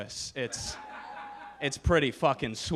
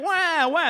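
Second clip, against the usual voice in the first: much higher, louder fake baby wail; mock baby crying; 13 semitones higher, 7 dB louder than usual. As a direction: wail like a bawling infant. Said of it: "they were just fucking crying".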